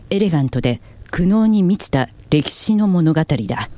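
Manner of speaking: slow, weighty program promo read; documentary narration. Shown as a demonstration. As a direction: narrate it with grand gravity.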